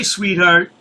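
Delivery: normal talking voice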